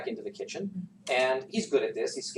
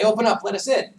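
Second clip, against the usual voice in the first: loud, higher shout to open; shouting guards; 10 semitones higher, 9 dB louder than usual. Acted. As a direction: demand at the door, loud. Guards pounding louder.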